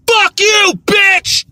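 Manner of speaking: full scream, high and loud; high-pitched screamed insult. Shown as a demonstration. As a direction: scream it in outrage. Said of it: "difference between screaming, which is this".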